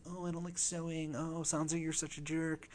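a hint of a squabbling little girl; quoting young Arya as a whiny child; childish Arya voice. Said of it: "it was obviously".

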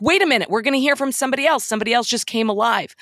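quick, high startled realization; wait, something just changed, said fast; sudden surprise. Pretend. Film viewer suddenly surprised by a new voice, fast and high. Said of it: "It's like".

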